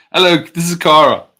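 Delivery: cutesy pet introduction. Real, not acted. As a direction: present the dog in a cutesy voice.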